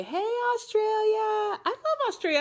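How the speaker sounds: high-pitched, delighted shout-out to faraway fans; excited greeting. Real, not acted. Calling out to fans in Australia.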